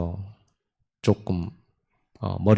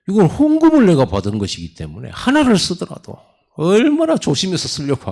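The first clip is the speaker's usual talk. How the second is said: emphatic preaching; 9 semitones higher, 3 dB louder than usual. Acted.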